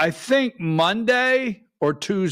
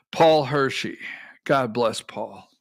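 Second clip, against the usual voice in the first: ordinary talk, nothing marked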